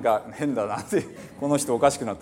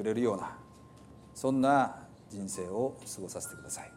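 prayer; 2 semitones lower, 4 dB quieter than usual. Acted. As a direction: pray quietly over the congregation. Leads a closing prayer. (静かに祈る牧師の声)

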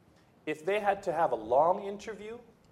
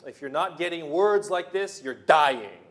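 much louder, punched warning; preacher's shout; 8 dB louder than usual. Acted. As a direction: hammer it home with full force. Repeated warning, stronger take.

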